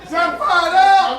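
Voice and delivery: high, drawn-out shout; fired-up rally call. Shown as a demonstration. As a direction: belt it to raise the crowd's energy. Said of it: "Let's try that again".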